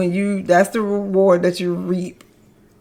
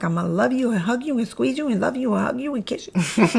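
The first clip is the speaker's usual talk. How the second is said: cartoon character voice; 3 semitones higher, 5 dB quieter than usual. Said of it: "the cartoon character was like".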